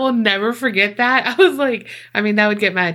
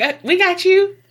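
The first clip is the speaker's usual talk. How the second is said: hero rescuer; 10 semitones higher than usual. Pretend. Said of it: "just be like".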